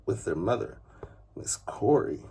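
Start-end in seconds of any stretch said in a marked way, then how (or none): none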